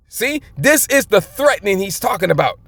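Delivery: usual speaking voice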